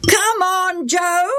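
put-on high boyish taunting call; teasing schoolboys calling; boys chorusing. A narrator's reading. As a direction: egg him on together. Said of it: "they chorused".

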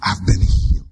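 healed leper; low declaration. Acted. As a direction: proclaim healing.

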